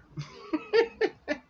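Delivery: a high, gleeful burst of laughter at rival fans; gloating laugh. Real, not acted. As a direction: laugh in delight at the rival team losing.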